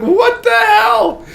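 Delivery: very high, drawn-out retold yell; high-pitched mock-outraged cry; indignant yell. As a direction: squawk it slowly in outraged disbelief. Retold shock at being run over.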